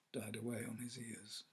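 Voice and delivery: plain reading voice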